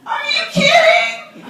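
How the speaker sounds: high, loud, laughing disbelief; excited laughing shout. Real, not acted. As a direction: burst out with gleeful disbelief.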